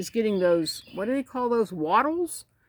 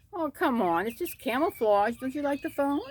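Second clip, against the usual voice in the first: higher, cajoling lilt; coaxing pet talk; 7 semitones higher than usual. Real, not acted. Keeper asking birds about the phone, coaxing.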